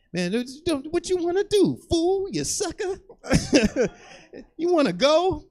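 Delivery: very high squeaky trash talk; uncle's eighties trash talk. Retold or imitated. Uncle squaring up to a big man, very high and squeaky. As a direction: squeal the challenge like an old-school tough. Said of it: "And he's like".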